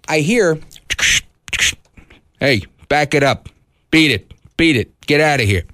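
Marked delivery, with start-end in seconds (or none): 2.4-5.6 imitated cop on a car loudspeaker; short, separate commands as if over a walkie-talkie; police loudspeaker. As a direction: mimic a police PA order. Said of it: "on their loudspeaker over that walkie-talkie".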